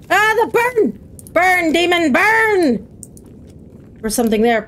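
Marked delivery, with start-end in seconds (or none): 0.1-2.9 high, hammed-up demon-slayer cries; mock battle cry. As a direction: cry out high as you torch pretend demons. Fighting demons in the game.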